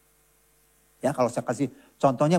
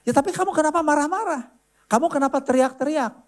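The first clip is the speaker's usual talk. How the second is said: higher, puzzled, pressing questions; wife's puzzled complaint; 9 semitones higher than usual. Retold or imitated. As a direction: ask it like a wife who can't see why he's upset. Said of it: "istri saya bingung".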